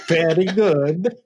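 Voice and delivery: slow Italian-accented "Very good" through a laugh; mock Italian accent, laughing. Shown as a demonstration. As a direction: play the Italian chef, slowly. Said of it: "with, like, an Italian accent".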